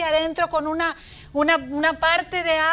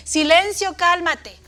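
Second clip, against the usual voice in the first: higher, louder command; commanding order; 4 semitones higher, 6 dB louder than usual. Acted. Reading the children's version, a loud command. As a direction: order the wind to be still. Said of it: "que dice".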